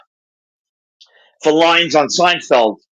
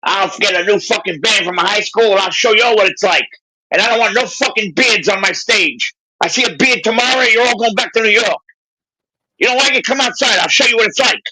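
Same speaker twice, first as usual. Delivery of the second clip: high-pitched mock tirade; 8 semitones higher than usual. Bandleader threatening his band over beards, imitated tirade. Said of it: "he's threatening his band, telling them".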